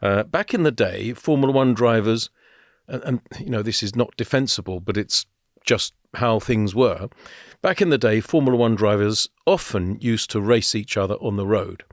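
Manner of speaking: usual speaking voice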